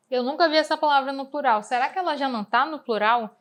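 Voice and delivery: ordinary delivery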